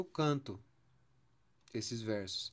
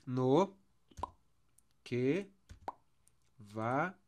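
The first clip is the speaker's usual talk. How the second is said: long pauses between single syllables; one beat at a time; dividing into syllables; much slower than usual. Shown as a demonstration. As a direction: isolate each syllable for the class. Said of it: "as palavras pra gente dividir".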